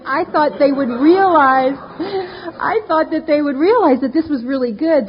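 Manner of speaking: slow, voice breaking with tears; real emotion recalling the therapists' reaction; tearful. Real, not acted. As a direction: let the tears come through. Tearful memory of hopes.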